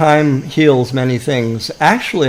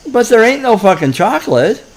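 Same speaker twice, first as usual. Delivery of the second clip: put-on higher, indignant little boy; a fed-up kid blurting out the obvious; kid at the counter, exasperated; 8 semitones higher than usual. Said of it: "he said to the woman".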